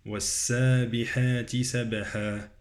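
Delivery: bouncing b in "سبحا"; recite, releasing the b of "سبحا" with a tiny echo; qalqalah on ba. Shown as a demonstration. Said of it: "قلقلة على الباء".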